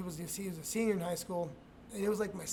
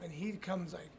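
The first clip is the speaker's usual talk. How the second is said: ordinary talk, nothing marked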